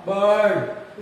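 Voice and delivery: बर्ड with a long, drawn-out er vowel and no r. Shown as a demonstration. Teacher models the long vowel in bird for students, stretched. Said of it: "तीसरी ध्वनि है अ लंबा".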